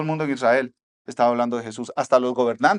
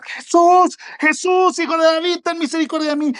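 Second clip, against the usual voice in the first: much higher, louder pleading shout; desperate shout; 13 semitones higher, 5 dB louder than usual. Retold or imitated. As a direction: cry out across the crowd for mercy. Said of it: "empieza ahí al lado del camino a gritar".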